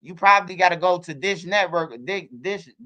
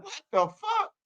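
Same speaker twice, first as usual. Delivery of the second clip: high, softened incredulous question; mock disbelief; 8 semitones higher, 5 dB quieter, slower than usual. Dad reacting to his son's cartoon, high disbelief. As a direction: replay your shock in a raised pitch. Own shocked reaction, retold.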